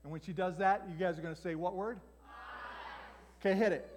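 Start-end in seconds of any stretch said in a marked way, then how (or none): none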